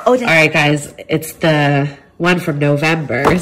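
usual speaking voice

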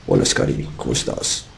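very deep, breathy seductive voice; sexy voice. Pretend. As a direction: drop way down and breathe it. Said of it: "No me imagino esa voz en la noche".

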